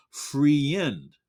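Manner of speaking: friend drawn into two syllables, "free-end"; spelled-out wrong pronunciation. Shown as a demonstration. Teacher reading friend as it is written, mispronounced. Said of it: "that's not how you sound it".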